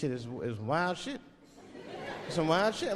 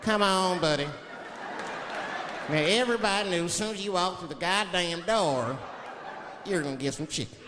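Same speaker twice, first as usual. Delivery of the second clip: Southern restaurant man; 2 semitones higher than usual. Retold or imitated. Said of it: "He looked at me like I was crazy".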